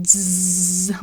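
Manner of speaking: long electric zzz buzz; drawn-out vibrating buzz. Retold or imitated. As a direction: buzz like frayed nerves. Buzzing noise for her vibrating nerves.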